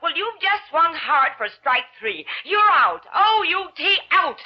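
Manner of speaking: slow burn. Acted. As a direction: let the simmering temper build to an ultimatum. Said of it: "It was Missus Casey doing a slow burn".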